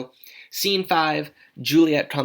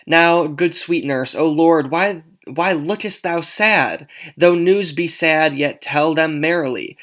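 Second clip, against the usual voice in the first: louder, animated dramatic reading; pleading Juliet; 3 semitones higher, 7 dB louder than usual. Acted. Juliet begs the nurse for news, loud and urgent.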